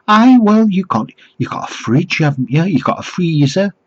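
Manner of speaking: high-pitched, put-on Geordie; mock Geordie accent. Fish seller answers where to store it, mock Geordie. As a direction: press the point in mock Geordie. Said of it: "I don't think it was a genuine accent".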